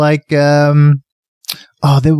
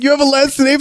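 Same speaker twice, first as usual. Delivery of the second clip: high mock disbelief; 10 semitones higher, faster than usual. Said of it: "He's like".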